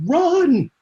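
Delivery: a high, loud warning yell; urgent yell. Retold or imitated. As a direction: shout at him to get away. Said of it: "trying to scream any kind of direction".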